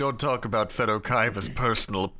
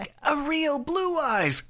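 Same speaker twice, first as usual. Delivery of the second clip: raised, hammy pitch; high-pitched quoting voice; high mock quote; 12 semitones higher than usual. Acted quote from dub.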